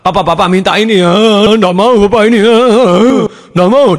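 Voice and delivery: high mock crying of a begging child; whining child. Child begging papa for a toy, mock whining. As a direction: whine and wail like a spoilt kid. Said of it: "dia nangis, dia merengek".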